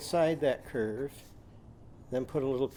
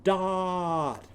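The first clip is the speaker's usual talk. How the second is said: "Dot" drawn out long on a raised vowel; "Dot" slowly, higher, with a held vowel; slow-motion word; 4 semitones higher than usual. Shown as a demonstration. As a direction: stretch the middle of "Dot" for sounding out. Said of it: "Show me the next sound you hear in dot".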